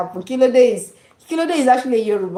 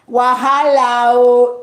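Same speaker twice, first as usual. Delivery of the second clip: loud, drawn-out exclamation; exclaimed slang; 3 semitones higher, 6 dB louder, slower than usual. Shown as a demonstration. Showing a friend Nigerian slang, loud drawn-out exclamation. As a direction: stretch it out and push the volume.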